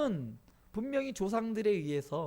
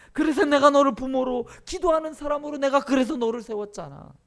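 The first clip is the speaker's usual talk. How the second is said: high, emphatic insistence; God's answer; 7 semitones higher, 7 dB louder than usual. Acted. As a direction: press the point with raised voice. Voicing God's insistent answer to believers. (높은 목소리로 힘주어 일깨우기)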